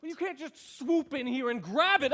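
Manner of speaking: loud, high protest building to a shout; escalating, indignant yelling; agitated outburst. Acted. Conductor protests the baton being taken.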